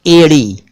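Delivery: "एड़ी" starting on a narrow ay; correct close vowel. Shown as a demonstration. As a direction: say "एड़ी" as EY-ree with a flapped r. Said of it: "एड़ी नहीं".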